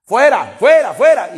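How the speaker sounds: whiny demons refusing to leave, building to shouted fuera; mock demon protest rising to a shout. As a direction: play the stubborn demons who won't go, then yell them out. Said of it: "Normalmente dicen".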